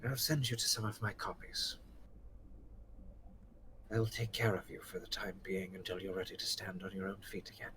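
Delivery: soft reassurance. Acted. Gorthrak comforts halfling, cupping head.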